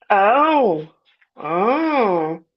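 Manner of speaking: hammed-up fake sobbing "oh, oh"; badly faked whimpering sobs; mock crying. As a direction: pretend to cry over the threat, sarcastically. Mocking fear of a lawsuit threat.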